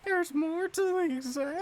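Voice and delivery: very high, cartoonish voice; squeaky mimic.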